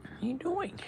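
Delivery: high, soft cooing to the pet. Real, not acted. Greeting his cat as pretty boy, in a high cooing voice.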